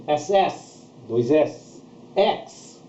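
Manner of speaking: ordinary talk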